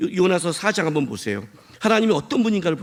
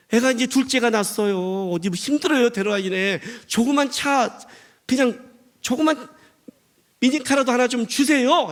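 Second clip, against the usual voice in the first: higher-pitched, wheedling appeal; pleading prayer; 6 semitones higher than usual. Acted. Parent begs God for a small car, pleading. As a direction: beg with put-on neediness. Believer voiced begging for car.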